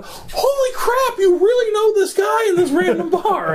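high-pitched mock outburst; furious disbelief. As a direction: imitate an exasperated woman's outcry. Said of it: "she was just furious".

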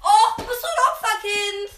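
high teasing laugh-yell; laughing shout. Real, not acted. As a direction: tease loudly while laughing.

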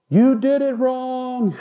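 high, drawn-out heckle; mock yelling. Preacher imitating people yelling from the back of the crowd, mock yelling. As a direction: call it out like a distant heckler. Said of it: "people in the back of the crowd yelling".

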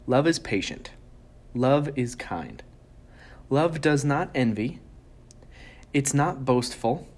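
solemn Bible reading with pauses; scripture reading. Acted. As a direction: read each line as a weighty pronouncement. Reads scripture aloud.

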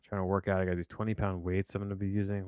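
ordinary talk, nothing marked

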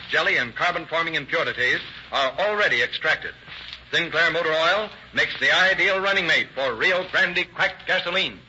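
usual speaking voice

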